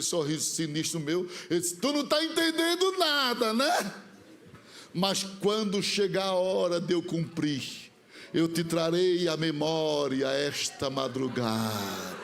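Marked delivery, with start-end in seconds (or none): 1.8-3.9 prophet's rebuke. Retold or imitated. Prophet rebuking smirking boy. 4.9-12.2 low, slow, grave voice; solemn prophecy. Retold or imitated.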